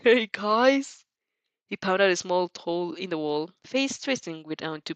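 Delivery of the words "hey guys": ringing call. Acted. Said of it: "Mineta's voice rings out".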